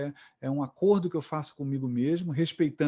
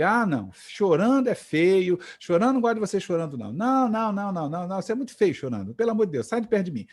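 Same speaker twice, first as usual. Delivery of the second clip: higher, louder imitation of a mom; mock scolding, pitched up; scolding mother; 5 semitones higher, 4 dB louder, faster than usual. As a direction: act out a mother's exaggerated disapproval. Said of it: "eu sempre falo pra ele".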